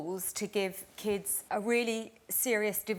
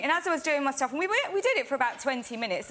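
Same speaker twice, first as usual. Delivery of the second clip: ordinary delivery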